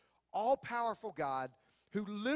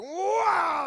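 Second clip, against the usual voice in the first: startled yell; 10 semitones higher, 12 dB louder than usual. Retold or imitated. Bike kicks into higher gear.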